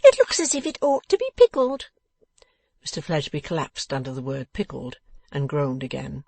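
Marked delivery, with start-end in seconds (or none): 0.0-1.9 high, loud, gleeful piping; silently chuckling. A narrator's reading. Dressmaker judging the thrashed back, amused and high. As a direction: deliver the verdict with suppressed amusement. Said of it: "with a silent chuckle".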